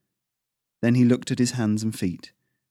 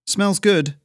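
put-on much higher, louder boyish blurt; 11 semitones higher, 6 dB louder than usual. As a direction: let it burst out before thinking. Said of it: "Kai blurted out".

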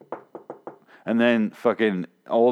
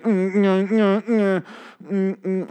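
high, loud, humming nervous mimicry; mocking Eugene's tics; 9 semitones higher, 3 dB louder, slower than usual. Reviewer mocks Eugene's tics, high nervous hum. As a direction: hum and blurt like a twitchy nerd. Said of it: "He starts like".

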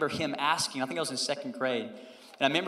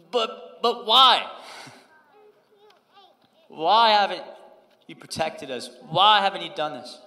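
upset; 4 semitones higher, 9 dB louder, slower than usual. Retold or imitated. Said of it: "I'm still upset".

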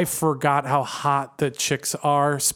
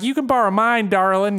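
imitated Southern old lady, higher pitched; southern accent; 5 semitones higher than usual. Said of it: "she's got a southern accent".

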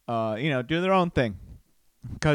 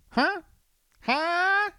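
high squeaky teasing huh?. Acted. Nudging his inner self, high teasing huh. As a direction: poke at himself with a high huh. Playful prodding to himself.

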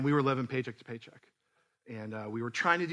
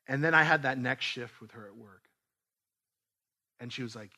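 usual speaking voice

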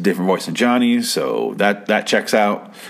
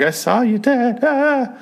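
hysterical woman; slower than usual. Retold or imitated. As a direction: imitate her going insane in a thin high voice. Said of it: "she's going insane".

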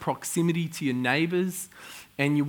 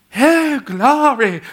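high, loud hammed-up "Hey, glory"; mock charismatic shout; 10 semitones higher, 8 dB louder, much slower than usual. Pastor mocking charismatic churchgoers, high hammed-up cry. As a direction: parody an over-the-top worshipper. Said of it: "You know, like".

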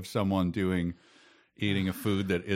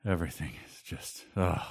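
ordinary talk

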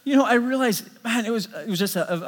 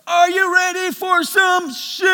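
slow hype shout, much higher and louder; yelling out; 12 semitones higher, 9 dB louder, slower than usual. Retold or imitated. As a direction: belt it to the crowd like an MC. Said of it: "I yell out".

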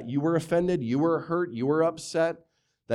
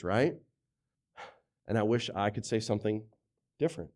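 ordinary delivery

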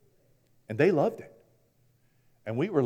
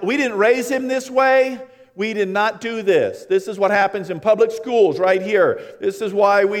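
defensive parent; 6 semitones higher, 7 dB louder than usual. Retold or imitated.

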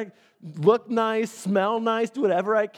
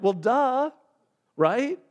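high sing-song duh; mock duh; 7 semitones higher, 4 dB louder, much slower than usual. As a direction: roll your eyes at it. Imagined audience reaction.

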